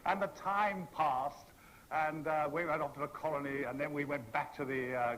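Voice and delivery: usual speaking voice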